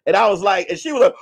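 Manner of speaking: ordinary talk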